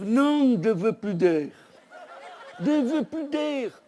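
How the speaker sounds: high, loud, slowed pained protest; hurt paraglider pushing the women away, high and slow; injured man groaning. Retold or imitated. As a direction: drag it out in pain. Injured man refusing air.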